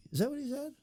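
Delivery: high, fast, excited telling-on; eager gossiper. Retold or imitated. Townsperson passing on the mayor's praise, high and eager. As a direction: spill the news like a gossiping neighbour. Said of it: "And then they will say".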